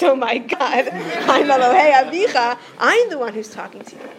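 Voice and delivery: loud, emphatic declaration with laughter at the start; God's correction. Acted. God corrects Moshe about who is talking, loud mock-grand proclamation. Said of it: "And then God says".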